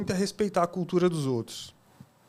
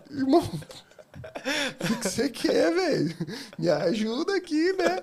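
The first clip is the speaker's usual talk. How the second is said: high, slow, laughing plea; half-laughing, mock-exasperated comeback; laughing retort; 9 semitones higher, slower than usual. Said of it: "Aí eu olhei pra ele e falei".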